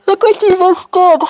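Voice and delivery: sudden high gasp of surprise; high, startled exclamation; surprised. Real, not acted. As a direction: caught out, pointing at the yawn. Gasps at yawn contagion.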